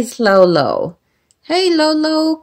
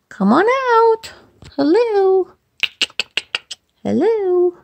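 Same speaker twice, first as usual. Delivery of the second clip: much higher sing-song coaxing; pet talk; 11 semitones higher than usual. Real, not acted. Owner calling her bird out, high sing-song pet talk. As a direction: sweet-talk a shy bird.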